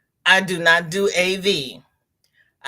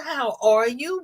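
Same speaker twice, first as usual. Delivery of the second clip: usual speaking voice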